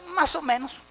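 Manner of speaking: high, shrugging "más o menos" voice; mocking a lukewarm member who shrugs everything off; mock lazy answer. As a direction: play the half-hearted believer. Mocking a mediocre churchgoer.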